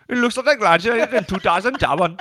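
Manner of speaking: high, loud put-on Indian accent; mock Indian scammer. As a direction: mimic an Indian scammer reading notes. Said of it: "The scammers are Indian".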